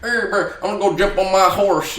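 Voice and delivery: high, loud cowboy twang, American Western; mock Western accent. European trying American and landing in a Western, mock cowboy accent. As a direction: imitate a foreigner's bad Western American accent. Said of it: "it always comes out as Western".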